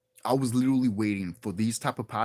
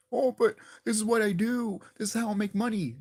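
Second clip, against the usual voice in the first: higher-pitched mock excuse; mocking protest; 7 semitones higher than usual. YouTuber mimicking podcasters who won't take breaks, mocking protest. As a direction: imitate a podcaster pleading their case.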